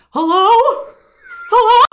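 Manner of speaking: sudden high, loud imitated call; startled-awake hello. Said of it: "That's exactly how it sounded".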